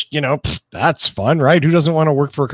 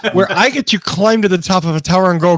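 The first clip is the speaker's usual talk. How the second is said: ordinary talk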